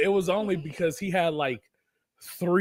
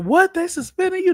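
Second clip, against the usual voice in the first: high, loud disbelieving outcry; shocked coworkers; 13 semitones higher, 6 dB louder than usual. Retold or imitated. Coworkers hearing he got suspended, shocked and loud.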